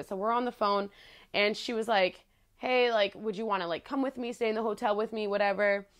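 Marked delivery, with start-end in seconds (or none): none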